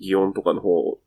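usual speaking voice

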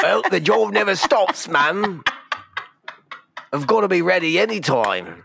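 high-pitched hammed-up Cockney; Cockney chimney sweep. Sweep explains the brush in his cell, high Cockney voice. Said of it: "Cockney eighteen eighties chimney sweep".

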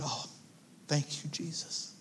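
soft, moved murmur; hushed thanks. Retold or imitated. Pastor recalls thanking Jesus on reading the words, softly moved. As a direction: let it fall away almost under the breath. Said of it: "I said".

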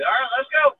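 high, loud whoop to roll out; hyped to ride on the roof; excited shout. Retold or imitated. Soldier on the roof cheering the convoy out, high and loud.

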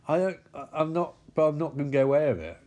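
ordinary delivery

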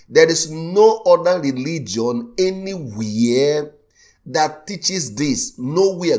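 loud, driving preacher voice pounding the word no; full-force preaching. Acted. Preacher to his congregation, insisting loudly on no other religion. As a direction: hammer it home from the pulpit.

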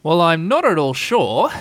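mock-pondering, unhurried delivery; thoughtful voice. A narrator's reading. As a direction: draw it out as if pondering. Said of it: "in a thoughtful kind of voice".

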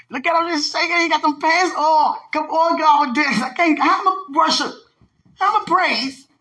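high, loud gushing; worked up. Real, not acted. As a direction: gush loudly, pitched right up high. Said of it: "let me calm it down".

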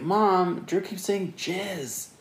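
high, slow whiny tattle; tattling kid brother. Retold or imitated. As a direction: whine it to mom like a little brother.

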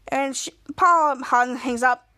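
plain reading voice

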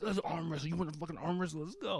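high, softened mock challenge; excitable high-pitched goading; crazy, not aggressive. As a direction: imitate a drunk grandfather egging someone on. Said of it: "not in an aggressive way, but just".

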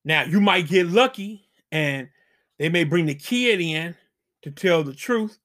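usual speaking voice

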